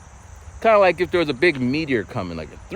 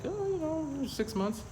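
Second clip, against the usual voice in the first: much higher, softer airy woman's voice; imitating the woman; 10 dB quieter than usual. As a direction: mimic her breezy offhand answer. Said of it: "She's like".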